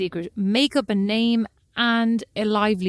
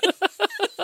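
laughing; 2 semitones higher than usual. Real, not acted. Laughing banter.

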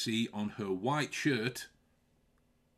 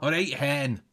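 loud Scottish greeting through laughter; laughing mock-Scottish hello; Scottish accent; 3 semitones higher, 8 dB louder than usual. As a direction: put on a Scottish accent and call out. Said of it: "in a Scotch accent".

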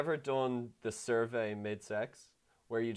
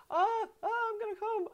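very high, squealing imitation; mock climax. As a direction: moan it in an exaggerated squeaky voice. Imitates partner's climax in fake story.

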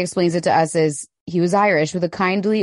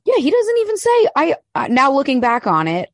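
higher, rising exasperation; indignant; 8 semitones higher than usual. Real, not acted. Host exasperated Harry never regrets it, high indignant.

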